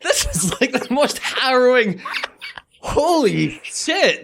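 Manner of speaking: high-pitched, stretched-out incredulity; aghast amusement. Real, not acted. Co-host reacts to a harrowing story, aghast amusement. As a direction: blurt it out in laughing horror.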